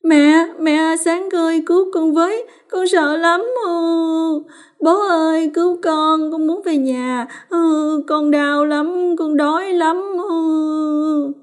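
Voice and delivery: put-on high-pitched child's voice, crying out for help; wailing frightened children. A narrator's reading. Terrified children tied to stone posts calling for their parents, wailing. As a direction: plead in a high, drawn-out child's wail. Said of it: "miệng không ngừng gào thét".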